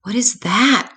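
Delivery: put-on high, wide-eyed child asking; in wonder. Little cub gazing at lights, asks in wonder. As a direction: marvel at the lights above. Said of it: "asked in wonder".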